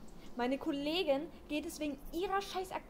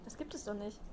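normal talking voice

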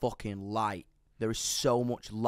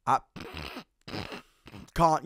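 ordinary talk, nothing marked

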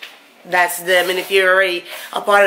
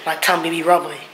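normal talking voice

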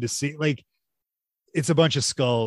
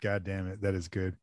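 usual speaking voice

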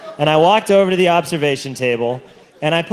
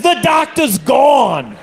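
mock shout; 8 semitones higher, much slower than usual. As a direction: yell it in exasperation. Batman shouting at him.

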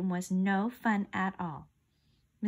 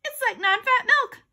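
high, loud explanation; eager shout; 6 dB louder than usual. A narrator's reading. Boy justifying his quiz answer, eager shout. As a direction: keep shouting your answer, pleased with it. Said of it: "I shouted".

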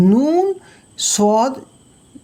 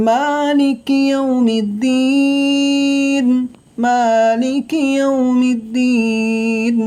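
slow, higher melodic chanting of the verse; Quran recitation; 4 semitones higher, much slower than usual. Shown as a demonstration. Teacher recites the whole verse for students, slow melodic chant. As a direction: draw each word out in a sung recitation tone. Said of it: "তাহলে পুরো আয়াতটি কেমন হবে?".

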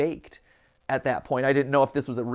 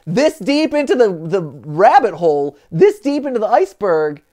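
excited; 6 semitones higher, 7 dB louder than usual. Real, not acted. Excited about deep lore find.

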